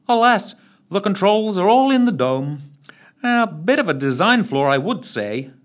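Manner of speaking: high, dry sarcastic remark; lightly sarcastic butler tone. A narrator's reading. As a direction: needle them gently. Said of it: "somewhat sarcastically".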